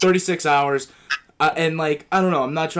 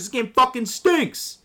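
angry rant; 10 semitones higher, 6 dB louder than usual. Real, not acted. Ranting about the game.

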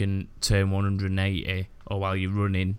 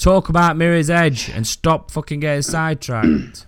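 mock angry; 7 semitones higher, 8 dB louder than usual. Real, not acted. Scolding friends off topic.

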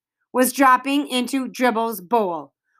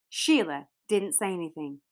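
ordinary delivery

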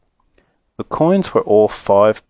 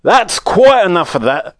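stern voice; 8 semitones higher, 4 dB louder than usual. A narrator's reading. Someone stops the bidding.

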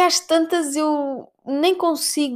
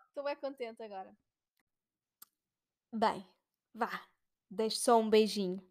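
hushed; 2 semitones lower, 14 dB quieter than usual. Real, not acted. Hushed after shouting.

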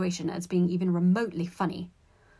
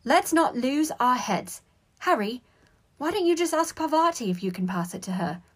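taking charge firmly; 6 semitones higher, 5 dB louder than usual. A narrator's reading. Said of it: "putting a stop to things".

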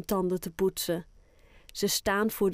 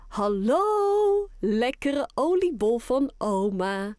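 happy greeting; 3 semitones higher, slower than usual. Acted. Grandma greets her grandson in the morning, high and happy. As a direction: greet the boy with delight. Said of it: "zegt oma blij".

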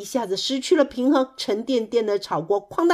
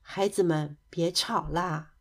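lower, softened grandmotherly appeal; gentle plea; 5 semitones lower, 6 dB quieter than usual. A narrator's reading. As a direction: hush the children wearily. Old bear stops the children's quarrel.

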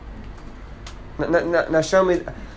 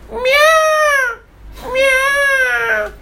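cat meow. Retold or imitated. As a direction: yowl like a cat. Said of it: "start talking like a cat".

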